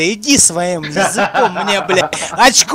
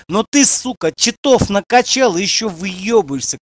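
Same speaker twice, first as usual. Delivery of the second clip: ordinary talk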